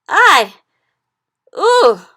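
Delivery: loud, high howls of pain; roared howls. A narrator's reading. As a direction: howl out in two separate bursts. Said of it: "roared the Flesh Lump Eater".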